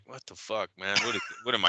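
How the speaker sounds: soft, low mock disbelief; Deontay. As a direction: grumble it quietly as an imitation of the boxer. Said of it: "you just see Deontay's like".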